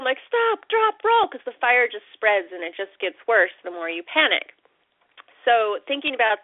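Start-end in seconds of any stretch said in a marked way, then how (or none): 0.2-1.3 high urgent yell of instructions; yelling at them. Retold or imitated. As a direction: shout it at someone on fire. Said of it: "you wanna yell at them".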